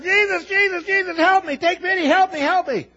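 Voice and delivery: a frantic father begging Jesus; desperate father crying out. Retold or imitated. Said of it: "one guy crying out".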